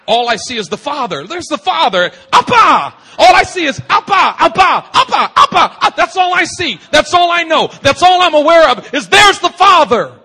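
loud, high, shouted preaching; full-force preaching. Acted. Preacher to congregation, roaring out the climax. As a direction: rise to a roaring climax about seeing only the Father.

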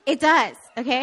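ordinary talk

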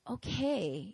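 acted lukewarm okay, trailing up; doubtful, noncommittal reply; unimpressed. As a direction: humour them without celebrating. Acting uncelebrating, doubtful listeners.

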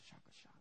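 breathy unvoiced chewing noise; imitated gum chewing. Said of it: "He's chewing his gum".

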